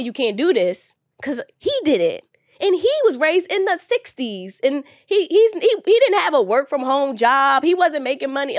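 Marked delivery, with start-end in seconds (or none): 2.6-8.6 impassioned. Real, not acted. Proudly describing her father's hardships.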